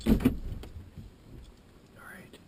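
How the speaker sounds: soft whispered right. Real, not acted. Hunter getting ready in the woods, whispering. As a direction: breathe the word out in a whisper. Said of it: "I don't know why I'm whispering".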